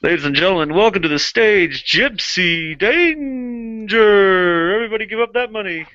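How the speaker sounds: stretched hype announcer call; strip club announcer. Pretend. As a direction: draw out the name like an MC.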